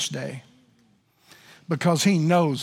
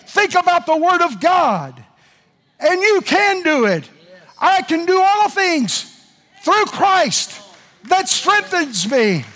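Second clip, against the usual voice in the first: high, loud preacher cadence building to a shout; revival-style preaching; fervent preaching; 9 semitones higher, 4 dB louder than usual. Acted. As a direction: preach it to the whole congregation. Preaching at full force.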